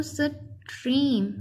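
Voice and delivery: Stream broken as "स", then "trim"; read in separate parts. Shown as a demonstration. Teacher showing the alternative reading, stream in two parts. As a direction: split stream after the first sound. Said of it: "वैसे अलग-अलग करके पढ़ें".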